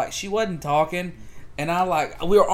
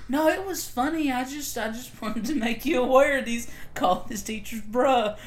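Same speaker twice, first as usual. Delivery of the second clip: amused teacher; 9 semitones higher, 3 dB louder than usual. Retold or imitated. Teacher reassuring mom, retold.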